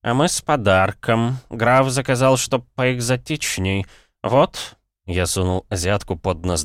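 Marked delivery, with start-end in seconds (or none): 0.0-4.8 slow, slurred tipsy babble; drunk slurring. Acted. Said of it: "пролепетал заплетающимся языком".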